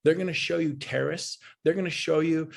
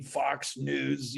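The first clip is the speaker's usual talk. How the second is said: ordinary talk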